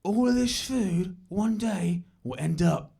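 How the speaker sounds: slow, weighty dad impersonation; mock-philosophical East London dad; would-be profound dad. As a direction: say it like a deep observation about life. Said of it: "things that he thinks are deep observations".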